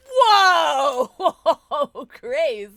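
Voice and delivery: loud laughing yelp; startled shout. Real, not acted. Jump scare shout.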